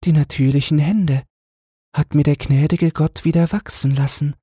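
high, gentle voice of the poor queen; the queen asks the angel, in a high gentle voice. A narrator's reading. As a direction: play the humble queen asking in wonder.

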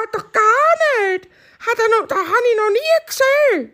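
very high clucking voice with ga ga stutters; clucking hen. Acted. Hens cackle at bunny.

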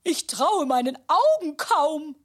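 normal talking voice

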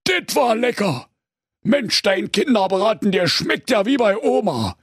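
lightly voiced satisfied Berlin ogre; contented ogre. A narrator's reading. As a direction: praise the meal lazily. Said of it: "und sprach".

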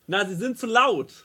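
high, soft, slow imitation of a complainer; scolding woman. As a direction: play the reproachful stranger, high and drawn out. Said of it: "eine Frau mich an". (vorwurfsvolle Frau, hoch und leise nachgeahmt)

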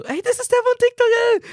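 very high mock squeal of recognition; imitated fan girl. Young girl in the background spotting the TikToker, imitated excitement. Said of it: "ich höre im Hintergrund schon so".

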